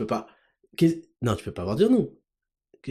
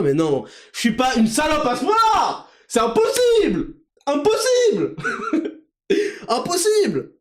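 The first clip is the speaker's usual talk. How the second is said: mock outraged denial; 9 semitones higher than usual.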